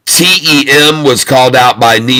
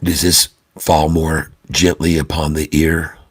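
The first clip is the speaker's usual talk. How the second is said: much lower, much softer golf announcer hush; 7 semitones lower, 7 dB quieter than usual. Acted. Streamer testing a softer golf voice for viewers, hushed golf commentary. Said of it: "Now we're gonna do the golf voice".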